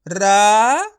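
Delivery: रा called out loud on its own; sounding out. Shown as a demonstration. Teacher sounds out the letter for students. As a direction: call out रा loudly. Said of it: "फा में औ की मात्रा फ".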